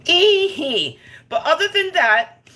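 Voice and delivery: high, loud excited "Ee-hee"; excited squeal. Real, not acted. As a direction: burst out high and loud with glee. Excited exclamation to viewers.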